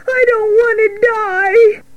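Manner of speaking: very high, drawn-out terrified wail; slow, high squealing cry; screaming. Acted. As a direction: wail it like a panicking piglet. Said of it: "screamed Wilbur".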